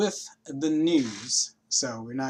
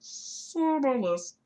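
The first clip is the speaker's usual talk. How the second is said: drawn-out high; 11 semitones higher, much slower than usual. Acted. Mic check, drawn out high.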